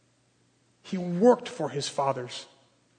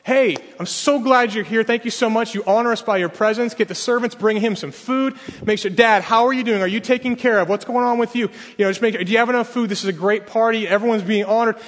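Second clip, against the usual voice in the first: higher, louder gushing party host; 6 semitones higher, 4 dB louder than usual. Retold or imitated. Older son as host greeting party guests, gushing and loud. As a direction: welcome every guest with loud cheer. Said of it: "He's supposed to be the schmoozer".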